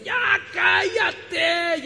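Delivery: put-on loud, high, drawn-out shout at a crying baby; frustrated parent shout. Preacher voicing a frustrated parent yelling at a crying baby, loud stretched shout. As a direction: bellow it like an exhausted parent losing patience. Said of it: "el papá o la mamá dice".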